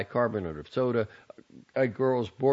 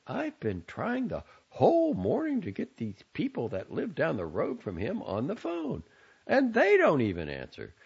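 mother fretting about her son without a phone; 13 semitones higher than usual. A narrator's reading. Mother frets.

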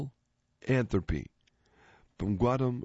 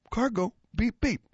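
high squeaky car going beep beep; 12 semitones higher than usual. Acted. Car in a knock-knock joke, squeaky high beep beep. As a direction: play the little car honking its way through.

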